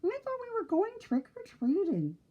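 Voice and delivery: high, impatient piggy voice. A narrator's reading. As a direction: whine it like a restless little pig. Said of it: "said impatiently".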